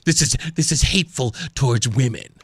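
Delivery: low, hushed mock-grave voice; imagined objectors. Host mimicking defenders of the older congresswoman, mock-grave. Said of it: "you know what they're gonna say?".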